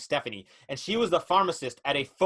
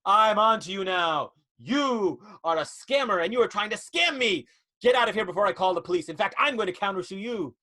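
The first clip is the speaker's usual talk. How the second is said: louder, higher hammed-up outrage; indignant accusation; 4 semitones higher, 3 dB louder than usual. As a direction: denounce the scammer grandly. Heroine exposes the scammer.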